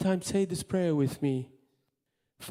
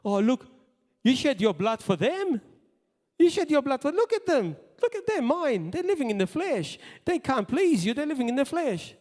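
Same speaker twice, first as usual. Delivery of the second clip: Satan the accuser; 7 semitones higher than usual. Acted. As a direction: taunt God like a gloating accuser pointing at sinners. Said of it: "Don't let the accuser go up".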